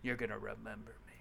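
hushed, fast final threat; dramatic whisper. Pretend. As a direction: breathe it out like a secret. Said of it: "Mic drop".

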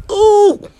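comic high-pitched ooh; high squeal. Acted. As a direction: squeal at your own outburst. After the mock outburst.

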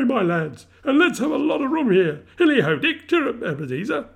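put-on jovial old master's high, loud call; rally the lads with merry gusto; hearty, sing-out shouting; Fezziwig. A narrator's reading. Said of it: "cried old Fezziwig".

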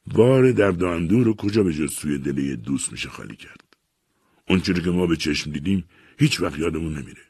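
usual speaking voice

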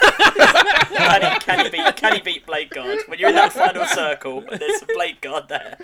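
high, loud laughing question; laughing excitedly. Real, not acted. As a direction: giggle out the challenge.

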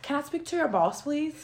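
high, soft entitled customer; a mild mock Karen asking for the boss; Karen impression. Mocking the Karen meme, high soft entitled request. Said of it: "Karen's the one".

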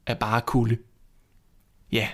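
plain reading voice

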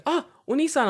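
put-on kid calling out. Kids calling back from platform.

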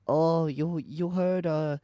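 imitating a Chinese accent, lightly. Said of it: "but she's Chinese".

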